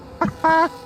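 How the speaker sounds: squeaky burst of laughter; quick delighted giggle; high excited laugh. Real, not acted. Laughs at rain.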